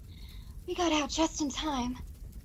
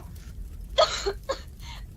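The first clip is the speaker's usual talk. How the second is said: rough smoky coughing fit; coughing in the burning hall; choking cough; 7 semitones higher, 3 dB quieter than usual. Acted. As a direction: choke on the smoke. Choking smoke.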